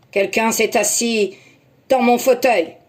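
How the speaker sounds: loud booming Papa Bear; Papa Bear to his family, loud and big; big gruff voice. Acted. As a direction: bellow like a huge bear. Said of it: "d'une très grosse voix".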